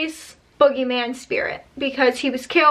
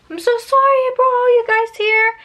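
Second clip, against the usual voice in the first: high, louder frightened plea; desperate apology; 9 semitones higher, 3 dB louder than usual. Retold or imitated. Joe praying in terror to his friends, high and pleading. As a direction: beg forgiveness in panic.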